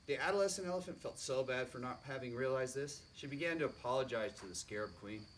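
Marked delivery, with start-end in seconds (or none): none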